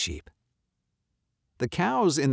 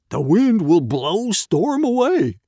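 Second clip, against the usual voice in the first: loud, high, slow dog voice; barking dog; 8 semitones higher, 8 dB louder than usual. A narrator's reading. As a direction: bark it out confidently. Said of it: "Dog barked".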